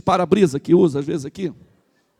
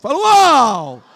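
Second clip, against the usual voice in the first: louder, higher and slow drawn-out wow; stronger shout; 4 semitones higher, 8 dB louder, much slower than usual. Shown as a demonstration. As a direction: push the wow bigger and longer. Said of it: "mais forte". (pastor pede mais forte: uau alto e arrastado)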